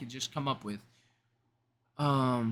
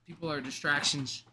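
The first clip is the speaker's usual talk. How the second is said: usual speaking voice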